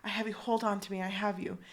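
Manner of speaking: soft, low acted comforting; gentle reassurance. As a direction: reassure quietly, as if pulling someone free. Anthony soothing Kate while pulling her.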